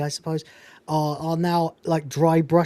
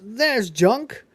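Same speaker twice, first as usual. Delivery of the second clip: lightly voiced higher character; a hint of the Cat, pitched up; 6 semitones higher, 3 dB louder than usual. Cat answering about junk, lightly imitated in a higher voice. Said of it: "well, that's the cat".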